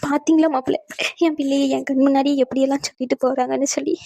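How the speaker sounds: hammed-up tearful complaint; put-on crying. Mother-in-law appeals to son-in-law for her daughter, fake crying. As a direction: wail your grievance, faking the tears. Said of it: "நீங்க ஏன் அழுவுறீங்க".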